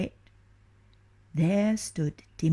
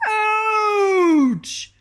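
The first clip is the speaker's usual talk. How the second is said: much higher, louder yelp of pain; ogre's pained cry; 9 dB louder than usual. Acted. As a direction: yowl as if stabbed in the toe. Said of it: "cried the ogre".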